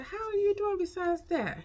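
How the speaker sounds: high-pitched retold question; friend checking in. Friend asking how she is, high-voiced imitation. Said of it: "So my friend said".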